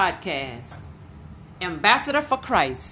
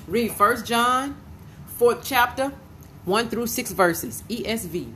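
dictating a reference; 2 semitones higher than usual. Real, not acted. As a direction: give the reference slowly for note-takers. Dictating a scripture reference to listeners.

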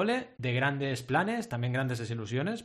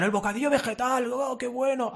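nostalgic rapture; 10 semitones higher, 3 dB louder than usual. Retold or imitated. Mimicking nostalgic schoolmates about sandwich.

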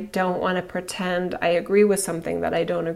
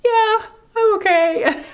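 put-on much higher, slower small-boy voice; little boy; 6 dB louder, slower than usual. The bullied boy answering the mom in a car, high slow child voice. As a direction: answer like a shaken little kid. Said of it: "And he goes".

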